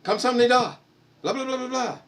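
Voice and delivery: squeaky sing-song impression of his wife; playful parody of a Korean phone greeting; imitating wife in Korean. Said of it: "And then she'd go".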